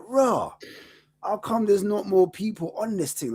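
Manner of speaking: high, incredulous mock exclamation; disbelief. Fan arriving at a tiny protest, high disbelieving cry. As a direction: sound baffled at the empty protest. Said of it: "I was like".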